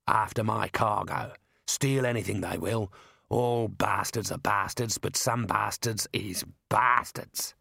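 slow, drawn-out, world-weary contempt; surly driver Mad. Acted. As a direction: grumble it slowly as a tough driver.

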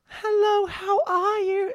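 very high, slow put-on assistant voice; loud, piercingly high mock assistant greeting; Siri. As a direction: squeal it slowly like a silly phone assistant. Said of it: "Siri just said".

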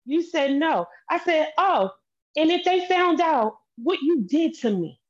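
high, agitated retelling; heated. Real, not acted. Heated questioning, high pitch.

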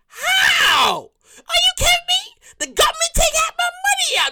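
very high, crying mock protest; outraged whine. Pleading with office workers about taxes, very high wail. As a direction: wail it like a cheated kid. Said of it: "I'm like".

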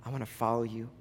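low, soft, earnest prayer. Real, not acted. Preacher leading people back to God, praying softly. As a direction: pray it quietly, with pauses between phrases.

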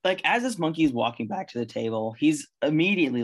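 ordinary delivery

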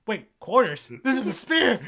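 offended yell that cracks into a laugh; indignant outburst. Retold or imitated. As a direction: Thor objecting to the cleaning advice, mock outrage. Indignant punchline quote.

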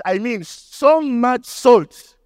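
slow, stretched "so much salt"; stress on so much. Real, not acted. Preacher stresses how much salt mom used, slow emphasis. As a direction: lean hard on "so much". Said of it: "When I say so much".

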